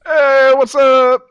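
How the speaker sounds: hyped greeting. Acted. Asked to say what's up.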